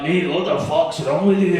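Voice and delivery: put-on lightly slurred drunk Scottish heckler voice; a hint of a pissed old Paisley guy; drunk Paisley man. Old pissed guy after the deathly silence, drunken Scottish voice. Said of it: "bald, pissed guy from Paisley like".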